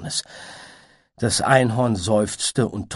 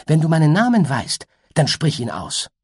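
higher, louder eager urging; urging unicorn; 9 semitones higher, 7 dB louder than usual. Acted. Unicorn urges the butterfly eagerly, higher and louder. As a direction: press the butterfly to say it.

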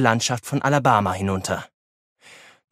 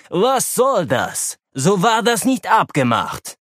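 angry; 7 semitones higher, slower than usual. A narrator's reading. Kasakow angry at pilots.

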